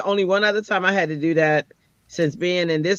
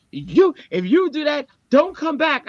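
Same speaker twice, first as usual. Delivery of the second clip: pitched way up, mock huffy boss; mocking the supervisor; 10 semitones higher, 3 dB louder than usual. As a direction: parody the fuming man's ultimatum. Retelling the angry supervisor.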